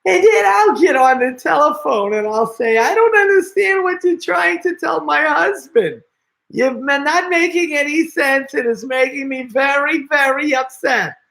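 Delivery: put-on very high-pitched upset old lady. Shown as a demonstration. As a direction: put on a high old wife's voice, scolding a phone scammer. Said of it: "I'll change it to an old lady's voice".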